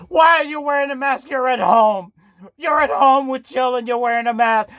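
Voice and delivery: usual speaking voice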